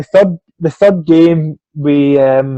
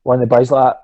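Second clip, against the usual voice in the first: ordinary talk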